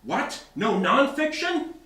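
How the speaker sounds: John bursts out, loud and high; shocked shout that editing isn't nonfiction; loud surprise. A narrator's reading. John to the editor, a loud astonished outburst. John exclaims, arms thrown up.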